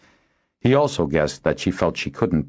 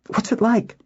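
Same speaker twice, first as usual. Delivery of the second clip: very high, eager question; excited, pitched-up eagerness; delighted curiosity. A narrator's reading. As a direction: ask with thrilled curiosity. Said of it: "Nuella exclaimed delightedly".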